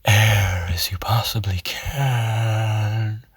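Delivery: airy, slow whispered release; breathy. Shown as a demonstration. Coach demonstrates the breathy opposite of constriction. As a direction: let out as much air as you can. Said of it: "or breathy".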